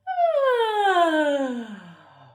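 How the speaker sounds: sigh-yawn falling all the way; downward yawn. Shown as a demonstration. Teacher modelling the downward yawn for kids. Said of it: "yawn it down again".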